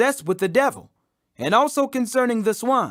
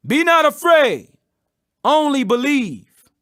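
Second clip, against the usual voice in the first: firm reassurance; 5 semitones higher, 5 dB louder, slower than usual. A narrator's reading. Jesus comforts the grieving father.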